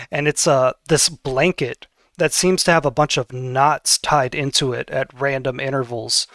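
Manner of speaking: normal talking voice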